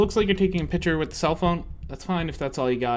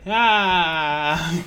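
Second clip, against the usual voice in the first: delighted gasp; 3 semitones higher, 6 dB louder than usual. Real, not acted. Delighted exclamation.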